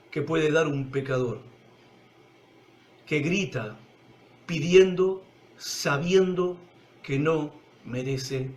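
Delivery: low, soft sermon voice sinking to a hush; intimate preaching. Acted. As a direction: draw the listeners close, quietly. (predicación íntima, baja y suave)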